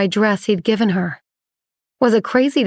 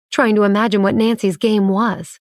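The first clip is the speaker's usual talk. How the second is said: ordinary delivery, nothing marked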